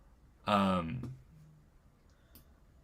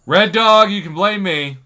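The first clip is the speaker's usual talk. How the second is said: much higher and louder call to a viewer; 8 semitones higher, 12 dB louder than usual. Real, not acted. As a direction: call out the name, then own the blame. Calls out viewer by name.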